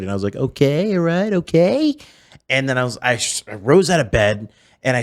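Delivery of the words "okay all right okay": higher, drawn-out okay, rising at the end; Donald Trump. Retold or imitated. Teenager practising after seeing TV, high Trump impression. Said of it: "says the word "okay" so weird".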